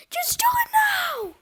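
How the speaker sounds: very high, drawn shouted command; desperate, piercing order; urgent scream. A narrator's reading. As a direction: scream it as time runs out. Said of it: "screamed Fluffy".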